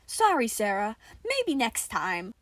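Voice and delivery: chipper. Acted. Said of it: "of a chipper tone".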